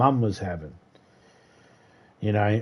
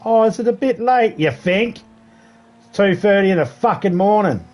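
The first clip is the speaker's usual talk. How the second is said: sarcastic angry telling-off; 7 semitones higher than usual. Retold or imitated. Scolding the partying teenagers at night, higher sarcastic anger. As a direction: let the sarcasm bite as you scold.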